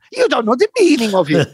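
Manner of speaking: mimicking him. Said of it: "before I even had a chance".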